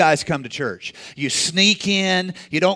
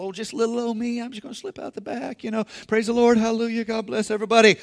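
high, much softer, sneaky quick voice; sheepish little-old-me woman; 4 semitones higher, 4 dB quieter, faster than usual. Acted. Woman sneaking out of the crowd, soft sheepish higher voice. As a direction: slip away sweetly, hoping nobody notices. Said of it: "she's like".

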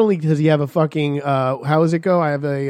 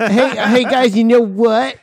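put-on high, loud, drawn-out kid pestering; eager pestering kid; 6 semitones higher, 5 dB louder, slower than usual. Mocking friend as a kid among older skaters, high and pestering. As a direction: pipe up like an over-eager kid. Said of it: "No, you'd be like".

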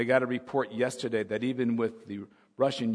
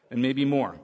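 usual speaking voice

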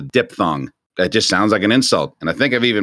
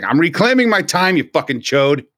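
mock politician; 7 semitones higher than usual. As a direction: snap at a colleague across the floor. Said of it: "It's like".

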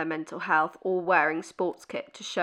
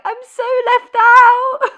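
whiny mock complaint; 13 dB louder, slower than usual. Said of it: "and I'm like".

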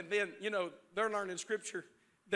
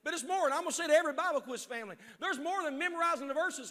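usual speaking voice

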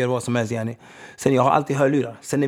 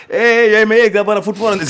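excited quote; 8 semitones higher, 9 dB louder than usual. Retold or imitated. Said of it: "Du känner bara".

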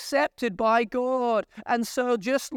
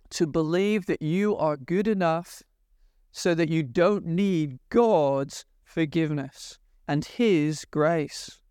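usual speaking voice

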